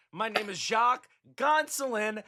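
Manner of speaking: ordinary delivery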